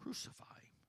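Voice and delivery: hushed, breathy crowd whisper of the sentence. Acted. The crowd answers Pilate in a whisper. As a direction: whisper it like a dark demand.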